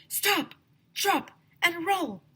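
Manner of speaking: high, loud chanted safety rule; shouting. A narrator's reading. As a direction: chant it urgently. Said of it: "shouted Andrea".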